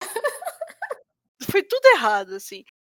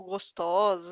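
tom sedutor; 5 semitones lower, much slower than usual. Shown as a demonstration. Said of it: "tem que ser a entonação certa também".

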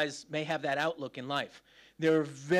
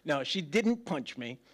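normal talking voice

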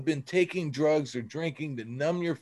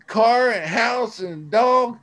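rising high and loud with joy, the last line slowed right down; excited triumph; 7 semitones higher, 7 dB louder than usual. Real, not acted. Telling the podcast how life got better, triumphant. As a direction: punch out the good news like a victory. Triumphant turnaround in recovery story.

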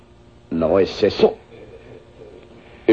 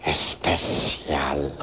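seductive hush; 9 semitones lower, 10 dB quieter, much slower than usual. Acted. Villain promising a special night, slow low hush. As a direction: drop very low and soft and draw it out slowly.

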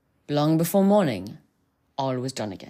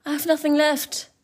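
high, pleading maiden; 12 semitones higher, 4 dB louder than usual. Acted. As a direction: offer it like a desperate girl. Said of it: "said she".

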